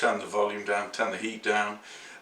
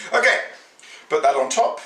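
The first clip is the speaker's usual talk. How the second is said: usual speaking voice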